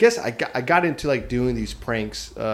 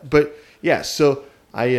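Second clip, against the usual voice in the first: usual speaking voice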